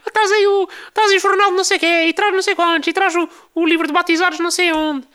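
put-on very high, loud old-lady voice; hammed-up shrill old ladies calling out across the library. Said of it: "que falam assim também". (voz de velhota muito aguda e alta)

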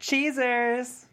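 high, sing-song photo cheer; excited photo call. Acted. Jordan calling his friends to pose, bright and playful. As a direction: call it out like a selfie countdown. Jordan posing for group selfie.

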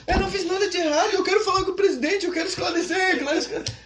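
high, loud, near-tearful pleading, quick; he thinks he's being sent home, plead; distressed pleading. Retold or imitated. Missionary pleading to call the president, high, loud, panicked.

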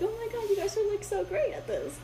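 put-on high-pitched gushing kid voice; imitated admiring sixth graders. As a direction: gush up at the big kids in a small, high voice.

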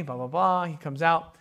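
ordinary talk

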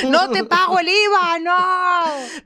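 high and loud, laughing; mock stingy boss. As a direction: refuse to pay like a cartoon miser boss. Imitando a la jefa. (jefa tacaña en broma, aguda y riendo)

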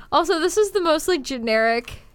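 ordinary talk

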